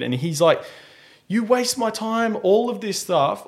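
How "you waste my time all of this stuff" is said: mock aggressive. Buyer berating the seller, mock indignant. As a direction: mimic an indignant customer up high. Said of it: "This guy's getting real aggressive".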